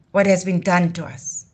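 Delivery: normal talking voice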